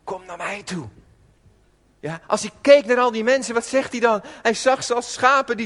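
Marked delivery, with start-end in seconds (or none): 0.0-0.9 much softer tender invitation; compassionate call. Retold or imitated. Jesus calling the lost to him, tender and soft. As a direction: invite them in gently, with love. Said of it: "oproep vanuit bewogenheid".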